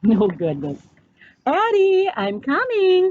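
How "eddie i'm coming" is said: calling out. A narrator's reading. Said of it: "called Mom".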